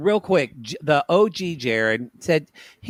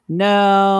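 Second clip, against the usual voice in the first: loud, raised "no"; mock denial; 4 semitones higher, 7 dB louder than usual. Answering whether she kissed yet, mock loud denial. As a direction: protest it loudly, play-acted. Retold denial to slumber-party question.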